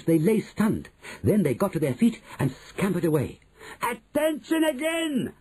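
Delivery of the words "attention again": very high, slow witch command; shouting. A narrator's reading. Grand High Witch calling her audience to attention, shout. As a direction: draw it out at high pitch. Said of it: "Grand High Witch was shouting".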